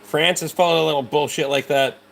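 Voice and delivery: ordinary delivery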